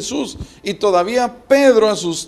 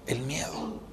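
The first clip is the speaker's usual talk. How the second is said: "El miedo" breathed out unvoiced, much softer; whispered answer; 7 semitones lower, 15 dB quieter than usual. Real, not acted. Preacher repeating to the brothers what sinks us, in a whisper. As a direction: drop to a whisper to let it sink in.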